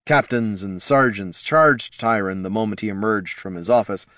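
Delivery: plain reading voice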